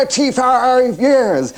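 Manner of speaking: put-on high, slow, drawn-out old man cry; Kenneth Williams old man. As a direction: stretch it out like a creaky old codger. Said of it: "he played his old man".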